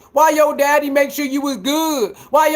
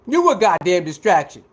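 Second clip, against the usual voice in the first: ordinary talk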